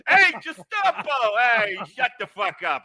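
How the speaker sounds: loud, high, hammed-up Brooklyn pizzeria shout; mock Italian-American pizza man yelling at staff. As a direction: bellow across the counter like a cranky pizza guy.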